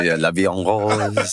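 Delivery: a quick, slow put-on French accent; mock French accent. Asked for his French accent, answering in mock French. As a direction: croon it in a hammed-up French accent. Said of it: "how's your French accent".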